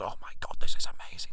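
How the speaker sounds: awed whisper of delight; husband whispering in delight at the fake arrest form; excited whisper. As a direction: breathe it in hushed amazement.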